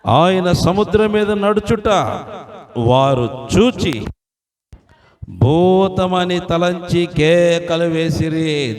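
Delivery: loud, high, drawn-out preaching proclamation; ringing, stretched-out preacher's declamation; full-force scripture reading. Acted. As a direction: proclaim the verse slowly to the whole congregation. Reads scripture aloud at full force.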